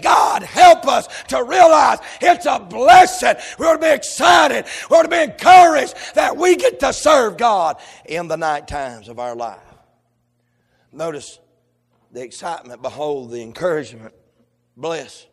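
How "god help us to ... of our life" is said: loud, rhythmic preacher's cry; hammering revival preaching; preaching at full force. Acted. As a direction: shout the night watch sermon to the church.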